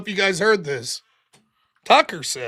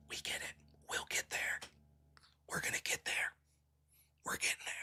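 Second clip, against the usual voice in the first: whisper; 20 dB quieter than usual. Real, not acted. Whispering reassurance to chat.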